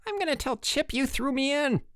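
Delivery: very high, strained threat; spluttering. A narrator's reading. Harold soaked, threatens to tell Chip, spluttering. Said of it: "he spluttered".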